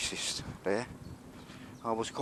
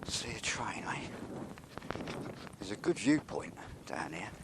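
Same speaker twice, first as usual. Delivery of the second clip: whisper; 7 dB quieter than usual. Real, not acted. Sneaking into the railway yard.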